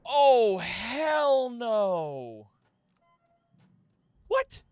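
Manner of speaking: loud, high "hell no"; horrified shout. Real, not acted. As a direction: recoil in shock. Horrified by the corpse.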